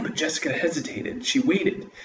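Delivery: plain reading voice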